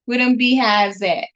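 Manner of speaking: ordinary delivery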